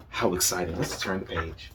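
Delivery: usual speaking voice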